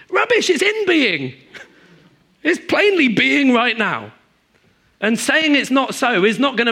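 loud, high scoff. Real, not acted. Preacher mocking positive confession, loud scoffing. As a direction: dismiss the denial with a loud scoff.

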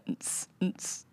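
soft unvoiced nts nts beat; whispered mock beatbox. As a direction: breathe out a tiny beatbox. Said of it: "she's like".

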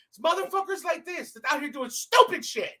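ordinary talk, nothing marked

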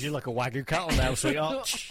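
loud, mocking husband; teasing partner voice. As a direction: tease her like a cheeky husband.

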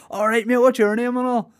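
high, cheeky local bloke voice; mock Belfast local. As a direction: greet the new guy like a jokey Belfast workman. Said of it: "acted dialogue with Scotty".

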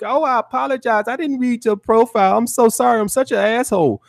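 high, pleading mock apology; grovelling apology. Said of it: "you gonna try to be like".

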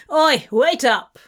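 Crawley calling out to Abraham to stop, loud; shout. Acted. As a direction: shout him down from a distance. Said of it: "Crawley shouted".

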